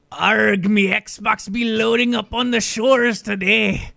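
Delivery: loud, high, slow hammed-up pirate. Asked to shill for Xbox as a pirate, loud hammed-up pirate voice. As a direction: bellow it like a cartoon pirate captain. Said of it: "like, a pirate voice".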